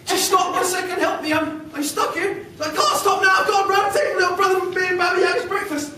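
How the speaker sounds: high West Country creature pleading; fish in a West Country accent. Acted. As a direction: call out in West Country, my lovely. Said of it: "get into the accent here".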